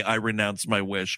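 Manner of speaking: low, solemn mock quote; lightly voiced low solemn vow. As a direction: intone it deep like a trance. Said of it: "hear Kristen Wiig say".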